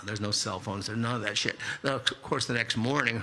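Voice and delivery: ordinary delivery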